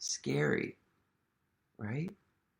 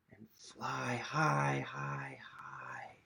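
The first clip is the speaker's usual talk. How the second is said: fading to whisper; 7 dB quieter, much slower than usual. A narrator's reading. Reader guiding kids to fly away, fading to a whisper. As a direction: let it float away softly.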